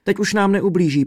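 high, urgent begging; prosebně, vysoko; pleading. A narrator's reading. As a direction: beg him to let father go. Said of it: "prosila Světluška".

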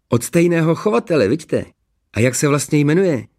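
high, eager questions; gushing neighbour. A narrator's reading. Neighbour asking about the dog's breeder, gushing. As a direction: chatter on, high and delighted.

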